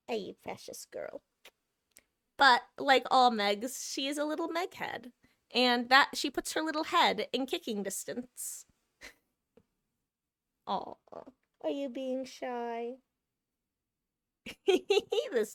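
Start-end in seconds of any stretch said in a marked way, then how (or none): 0.1-1.2 cooing to cat. Real, not acted. 10.7-12.9 soft, slow, half-whispered cooing; cooing to cat. Real, not acted.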